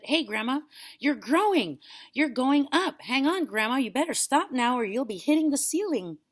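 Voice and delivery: put-on high, loud boy's warning; alarmed shout. A narrator's reading. Boy warning his growing grandma, alarmed shout. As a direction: holler up at her in alarm. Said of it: "George gave a jump and shouted".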